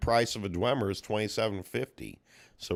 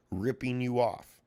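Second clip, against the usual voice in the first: usual speaking voice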